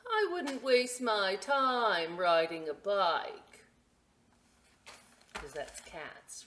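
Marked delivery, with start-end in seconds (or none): 0.1-3.7 cat's aloof thought. Acted. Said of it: "what she thought was".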